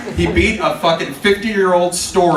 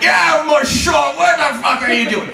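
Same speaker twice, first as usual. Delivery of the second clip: put-on high, loud pirate-like accent; exaggerated piratical accent, yelled high; 11 semitones higher, 4 dB louder, slower than usual. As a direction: shout it in a ridiculous pirate accent. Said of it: "He sounds like a pirate".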